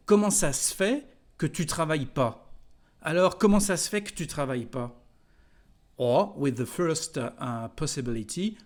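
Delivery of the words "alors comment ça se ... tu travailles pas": fast colloquial French. Shown as a demonstration. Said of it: "we will say".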